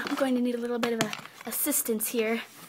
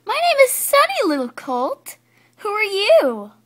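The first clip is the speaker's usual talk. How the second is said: high, loud, eager voice; bubbly introduction; 8 semitones higher, 7 dB louder than usual. Acted. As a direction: introduce yourself with bouncing enthusiasm. Excited colt introduces herself.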